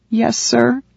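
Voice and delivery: high, cracking voice. Acted. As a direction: let the voice crack on sir. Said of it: "His voice cracked".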